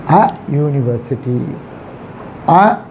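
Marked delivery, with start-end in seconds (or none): none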